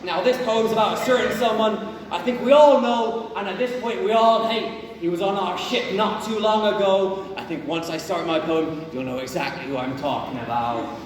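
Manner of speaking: rally the crew as their pirate captain. Acted. Plays a pirate captain addressing crew.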